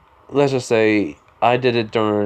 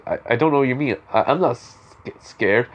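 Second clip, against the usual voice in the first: stuttered, defensive protest; nervous stutter. Acted. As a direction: trip over the first words, halting before the key one. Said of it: "The Bakugo I knew never stuttered".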